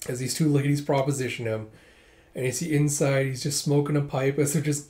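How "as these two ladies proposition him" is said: plain reading voice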